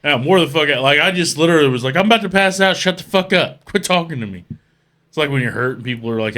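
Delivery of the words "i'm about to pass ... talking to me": high, loud, fed-up complaint; retold exasperated outburst. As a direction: snap at a buddy who won't stop asking questions. Retelling exhausted plea for silence.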